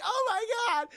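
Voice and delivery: very high panicked cry; mock panic. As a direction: imitate a woman fleeing a dog. Voicing woman chased by dog.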